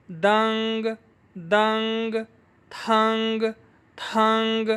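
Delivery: syllable drill like منگ، فنگ, each final g released; g made audible. Shown as a demonstration. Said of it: "یہاں میں نے جی ظاہر کیا". (منگ جیسے ہر لفظ کے آخر میں واضح گاف)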